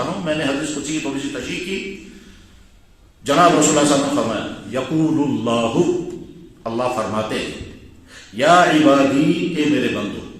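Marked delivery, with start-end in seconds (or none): 4.8-6.0 slow Arabic recitation. Acted. 8.3-9.5 slow Arabic recitation. Acted.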